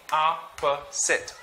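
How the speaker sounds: Opposite slowly, one syllable at a time; syllabicated. Shown as a demonstration. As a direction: say "Opposite" as "ah, puh, sit". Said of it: "Let's try to syllabicate".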